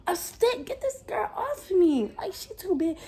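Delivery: high-pitched, exasperated complaint; strained plea. Retold or imitated. Pinned by a much bigger girl, high strained plea. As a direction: plead it high, as if squashed.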